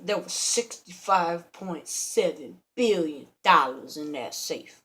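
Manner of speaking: ordinary talk, nothing marked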